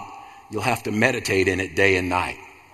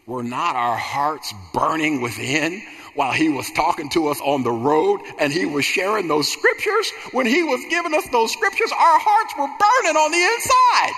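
building fervent preaching; 6 semitones higher than usual. Acted. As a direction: start near normal, climb to a very high shouted climax. Preacher voices disciples, building.

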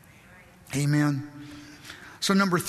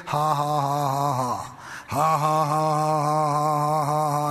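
low, monotone mock chuckle; steady, deep-pitched ha-ha-ha; Brother Hagin; 4 semitones lower, slower than usual. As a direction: imitate a private laugh kept under the covers. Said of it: "the covers over his head".

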